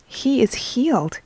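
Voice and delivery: ordinary delivery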